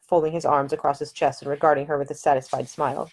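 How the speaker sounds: normal talking voice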